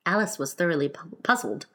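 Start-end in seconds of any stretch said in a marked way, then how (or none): none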